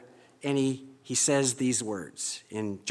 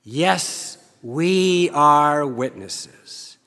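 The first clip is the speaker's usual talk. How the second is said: congregational response; 6 dB louder, much slower than usual. Shown as a demonstration. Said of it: "say it with me".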